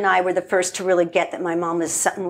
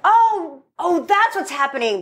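mocking her sudden aha; mock epiphany; 9 semitones higher than usual. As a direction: act out the lightbulb moment. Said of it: "She's like".

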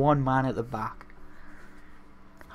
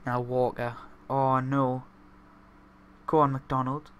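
normal talking voice

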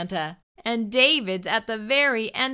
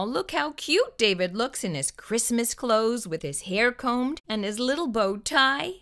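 ordinary delivery

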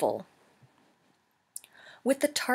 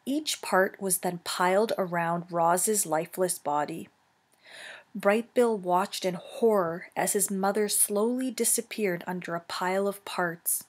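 usual speaking voice

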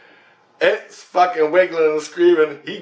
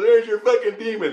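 much higher mock-hero voice; triumphant demon slayer; 9 semitones higher than usual. Storyteller repeating the slayer's line, high hammed-up triumph. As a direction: replay the punchline, amused and hammed up. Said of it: "He goes".

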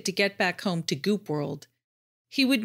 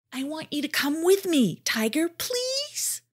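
pleading; 9 semitones higher than usual. A narrator's reading. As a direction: stretch out please, begging. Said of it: "pleaded Jardad".

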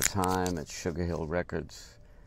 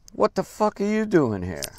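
retold rude challenge, higher and louder; mock angry snap; 11 semitones higher, 8 dB louder than usual. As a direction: bark it like an annoyed rival. Drummer snaps at the narrator.

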